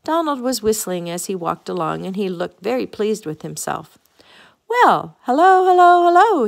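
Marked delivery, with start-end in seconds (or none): 4.7-6.5 big, loud, high-pitched hello; crying out. A narrator's reading. Donald greets the friends he happens to meet, crying out. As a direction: burst into a high, ringing greeting. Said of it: "he cried".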